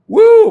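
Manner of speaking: loud, high "woo"; excited whoop. Real, not acted. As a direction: whoop it out after a big laugh. Whoops after laughing.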